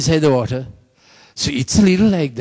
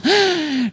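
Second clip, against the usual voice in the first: gasp; 13 semitones higher than usual. Acted. Dramatic gasp before Saul's armor.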